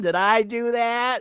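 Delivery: high nerdy sitcom catchphrase; Urkel. Retold or imitated.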